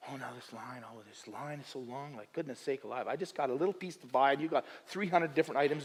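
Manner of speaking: soft, low grumble to himself; negative grumbling. Acted. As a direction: complain under your breath about the queue. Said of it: "I'm always so stinking negative".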